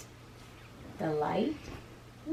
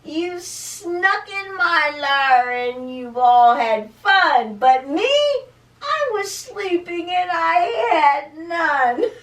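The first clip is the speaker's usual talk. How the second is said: loud, aggrieved storybook bear; hammed-up loud whine, rising to a wail; 6 semitones higher, 7 dB louder than usual. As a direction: complain tearfully as the left-out bear. Acts the upset bear's complaint.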